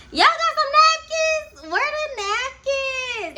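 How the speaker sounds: put-on very high, slow drunken call. Drunk friend asking for napkins.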